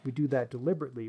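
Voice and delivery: ordinary talk, nothing marked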